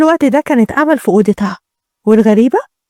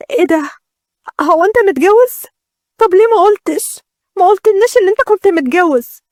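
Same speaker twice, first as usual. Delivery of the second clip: higher-pitched shocked questioning; 6 semitones higher than usual. A narrator's reading. Bride learning her groom is already married, shocked. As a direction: demand answers in acted disbelief. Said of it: "وبعدها أنا كنت مصدومة".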